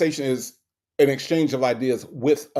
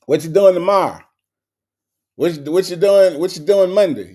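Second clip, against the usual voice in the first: loud, insistent mock questioning; mock pestering; 2 semitones higher, 6 dB louder than usual. Mocking an annoying man's endless questions, loud mock pestering. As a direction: imitate an annoying pest, punching it out.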